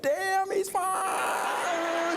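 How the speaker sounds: very high, long drawn-out swoon; swooning sisters. Retold or imitated. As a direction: mimic women admiring a handsome man. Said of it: "all the sisters was like".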